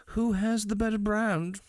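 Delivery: hammed-up villain announcer; weird villain accent. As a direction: sneer it like a cartoon villain. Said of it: "that weird fucking villain accent".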